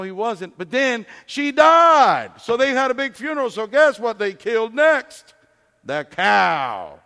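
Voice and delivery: loud, climbing climax; preaching at full force. Acted. Preacher telling the farm's deaths, loud and rising. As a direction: build to a shouting peak.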